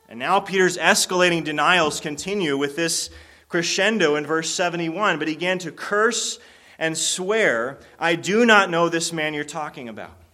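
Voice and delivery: preacher pushing to a loud peak; building preaching. Acted. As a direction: drive the story up to its climax. Preaches the denial's crescendo.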